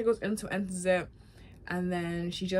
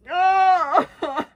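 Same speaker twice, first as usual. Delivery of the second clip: high, loud crying wail; drawn-out grief-stricken cry; hammed-up sob of grief; 10 semitones higher, 11 dB louder than usual. Reacting to seeing Joel's grave.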